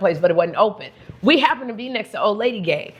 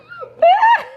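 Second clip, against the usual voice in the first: loud, high squeal of laughter; high shrieking laugh; 6 dB louder than usual. Real, not acted. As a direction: burst out in a piercing laugh. Laughing at own accent slip.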